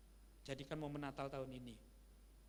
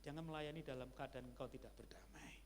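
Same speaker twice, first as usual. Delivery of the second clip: whisper; 7 dB quieter than usual. Real, not acted. Solemn exhortation to the congregation.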